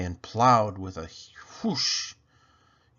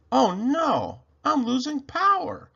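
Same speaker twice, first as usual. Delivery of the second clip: shrieking in panic; 6 dB louder than usual. A narrator's reading. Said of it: "shrieked Tingle".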